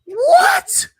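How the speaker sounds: very high, loud squeal of disbelief; comic falsetto scream; acted shocked shriek. Acts player's shocked reaction to coach.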